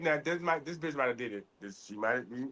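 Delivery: low, soft suspicious mutter to himself; a hint of a detective sizing her up, low and soft; mock suspicious murmur. Imitating his own inner voice judging a tearless woman, low and soft.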